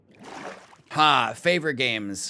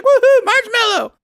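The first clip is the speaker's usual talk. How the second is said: very high, loud happy squeal; excited squeal; 12 dB louder than usual. Retold or imitated. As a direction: squeal with sudden delight. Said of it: "I was like".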